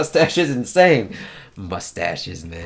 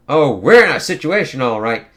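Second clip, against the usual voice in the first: louder, sarcastic throwback of his words; mocking echo; 2 semitones higher, 8 dB louder than usual. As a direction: throw his line back at him with scorn. Magik mockingly echoes his words.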